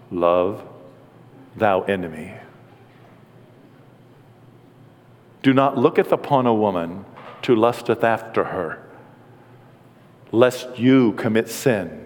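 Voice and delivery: mock-holy Jesus, intoning in a deep monotone. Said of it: "We think that he talked that way".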